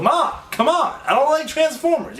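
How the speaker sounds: mock exasperated protest; exasperated son. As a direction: plead with your mother, pitch up. Pleading with mom in retold quarrel.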